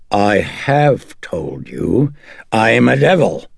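slow, loud, rising declaration; indignant demon. Acted. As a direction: proclaim it with drawn-out force. Demon insists on its identity.

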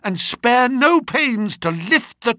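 high-pitched, impassioned appeal; acted agitated begging; desperate plea. A narrator's reading. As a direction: implore with mounting urgency. Distraught friend begs the detective for help.

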